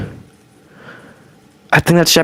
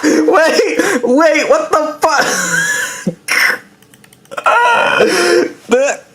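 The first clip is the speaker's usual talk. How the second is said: laughing; slower than usual. Real, not acted. Laughing reaction to video.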